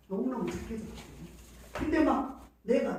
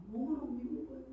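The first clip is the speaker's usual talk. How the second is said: 너무너무 repeated softly, higher; softer echo; 4 semitones higher, 6 dB quieter, faster than usual. Real, not acted. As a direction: say 너무너무 again as a softer afterthought.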